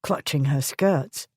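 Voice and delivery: ordinary delivery, nothing marked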